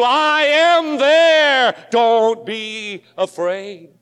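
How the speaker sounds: God's reply drawn out with emphasis; slow assurance. Acted. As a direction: stretch out I am there like a promise. Preacher voices God's answer.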